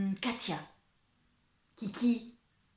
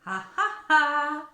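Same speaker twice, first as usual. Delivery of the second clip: hearty mock "ha, ha, ha"; playful stage laugh; 5 semitones higher, 6 dB louder, slower than usual. Storyteller teasing the young listener, playful stage laugh. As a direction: laugh it out theatrically in separate beats.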